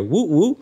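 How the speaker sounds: playful siren noise; imitated siren. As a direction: mimic a cop siren. Siren of a police car.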